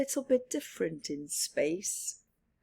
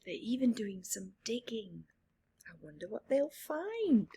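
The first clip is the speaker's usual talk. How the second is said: whisper; 10 dB quieter than usual. A narrator's reading. Storyteller whispers aside.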